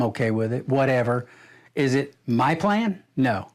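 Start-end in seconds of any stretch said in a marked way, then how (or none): none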